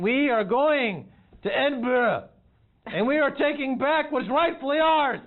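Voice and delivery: rally the clans like a warrior king; roaring tyrant king. Pretend. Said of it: "I am your king".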